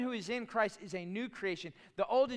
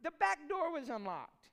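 high, casual excuse; breezy wife; 11 semitones higher, 5 dB louder than usual. Retold or imitated. Wife explaining the unlocked back door, high and offhand. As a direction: toss it off lightly. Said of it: "And she said".

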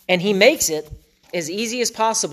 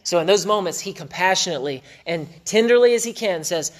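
normal talking voice

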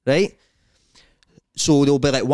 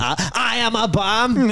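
high, drawn-out daft voice; put-on high-pitched bam boast; mock bam; 9 semitones higher, slower than usual. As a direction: declare it slowly in a silly high pitch. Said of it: "Imagine you walking about Carlisle".

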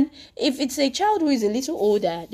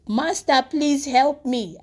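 mild retold cry for help, higher and louder; 5 semitones higher, 5 dB louder, slower than usual. Sinking Peter to Jesus, crying out for help. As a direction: call out for rescue, drawing out the plea. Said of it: "he cried out and said".

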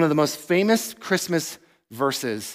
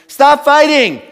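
put-on loud, high parent yell at fighting kids; fed-up father yelling at his fighting kids to stop; 9 semitones higher, 10 dB louder than usual. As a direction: shout it like a fed-up dad. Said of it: "I'm often just yelling at them".

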